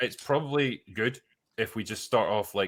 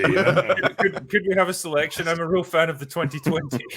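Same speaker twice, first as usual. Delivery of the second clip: higher, put-on snooty diner voice; mock posh connoisseur; 5 semitones higher, 3 dB louder than usual. Friend plays a snooty diner asking for a vodka selection, higher. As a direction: order like a snob at a wine list.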